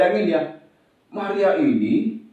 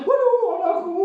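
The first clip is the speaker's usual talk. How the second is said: very high, acted wailing; mother's tearful wail; 13 semitones higher than usual. Crying mother embracing her found child, dramatised wail. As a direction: wail with overwhelmed relief, hammed up. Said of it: "mamanya yang tadinya udah nangis".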